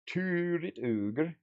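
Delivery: drawn-out mock monster voice; playful ogre voice. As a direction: stretch it out like a silly ogre.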